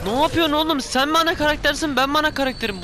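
worked-up comic outrage; indignant protest. Real, not acted. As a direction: challenge the opponent, affronted. Indignant at game enemy's attack.

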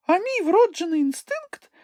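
very high squeaky little character; Chmykh announcing. A narrator's reading. Chmykh announces it is time to eat, squeaky announcement. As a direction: pipe it up in a tiny squeaky voice. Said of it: "сповістив Чмих".

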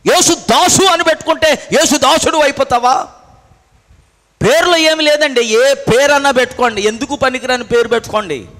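loud, high sermon voice; preaching at full force. Real, not acted. Preacher challenging believers about their names, forceful preaching.

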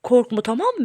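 usual speaking voice

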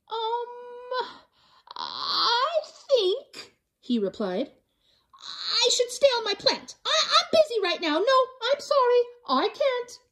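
high, hesitant little voice; shy koala. Acted. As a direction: nervously refuse the invitation. Kevin shyly refuses friends.

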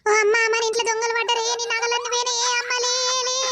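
high, panicked mock alarm to wake her; fake alarm. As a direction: pretend thieves broke in, cry out in alarm. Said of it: "అట్లా జోకేస్తే నువ్వు లేచినావు".

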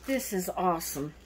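ordinary talk, nothing marked

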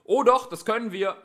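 high, loud rebuttal; shouted, insistent comeback; shouting. A narrator's reading. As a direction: yell back that you certainly can. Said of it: "schrie der Polizist".

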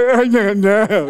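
put-on slow, laughing little-kid mumble; little boy. Youngest son answering his dad, slow and giggly. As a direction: imitate the toddler's shrug. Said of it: "He's like".

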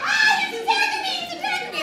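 very high, loud laughing shriek; hammed-up panic at a tiny dog; mock terrified scream.

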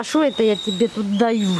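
normal talking voice

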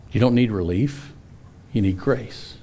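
ordinary talk

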